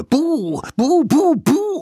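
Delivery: very high, loud scare shouts; roared boos. A narrator's reading. Little savages springing out at mammoth again, roared boos. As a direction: burst out with piercing boos. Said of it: "brüllten ihr".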